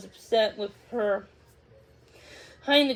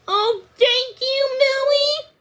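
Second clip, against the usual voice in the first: much higher, slow, overjoyed thanks; grateful gush; 12 semitones higher, 5 dB louder, slower than usual. Acted. Daisy thanking Millie, high and overjoyed. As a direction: pour out relief.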